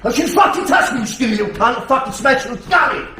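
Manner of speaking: high, loud furious yelling; scared and angry. Shown as a demonstration. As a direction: roar threats, spitting rage. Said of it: "so I'm now doing it".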